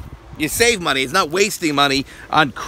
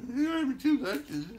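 mocking mimic; 6 semitones higher, 7 dB quieter than usual. Mocking wife's words.

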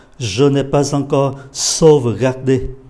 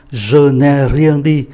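"Je n'ai rien dit" drawn out slowly and a bit louder; slow model sentence; 4 dB louder, much slower than usual. Shown as a demonstration. Teacher models the phrase slowly before explaining. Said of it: "tôi nói theo cái giọng mà khẩu ngữ".

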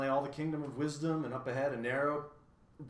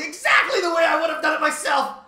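jovial bug; 16 dB louder than usual. A narrator's reading. Humbug congratulates Milo, jovial high shout. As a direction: gloat jovially. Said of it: "commented the bug jovially".

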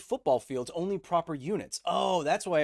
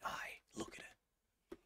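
slow unvoiced whispered aside; 19 dB quieter, slower than usual. Real, not acted. Streamer whispers an aside to chat about the girlfriend, hushed. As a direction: breathe it out under your breath, slowly.

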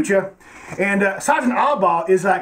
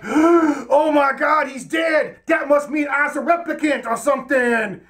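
high, very slow hammed-up shocked cry; a goofy stunned hero; mock shocked Harrison Ford; 6 semitones higher, slower than usual. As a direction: overplay the horror at his death. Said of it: "Harrison Ford is all like".

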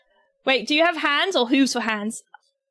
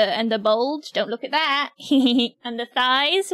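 ordinary talk

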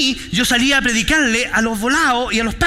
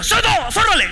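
high barked command; sergeant's shout; 9 semitones higher than usual. Retold or imitated. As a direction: yell it like a drill sergeant. Sergeant yelling orders at recruit.

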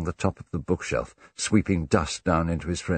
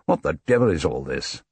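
louder, understated exasperation; irritated; 5 dB louder than usual. A narrator's reading. Hastings cannot follow the languages, irritated. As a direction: demand an explanation crossly. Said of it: "with a certain irritation in his voice".

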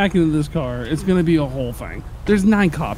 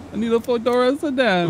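bad Italian accent; 6 semitones higher than usual. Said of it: "a guy doing a really bad Italian accent".

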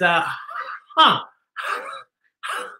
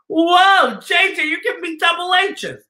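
excited shout; 10 semitones higher, 3 dB louder than usual. Real, not acted. Excited reaction.

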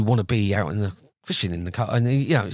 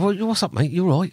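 pitched up high, bright and chummy; cheery mock greeting; 9 semitones higher than usual. Fishery owner answering his mate's call, pitched up and cheerful. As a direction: greet your mate like nothing's up. Mate calls after the catch.

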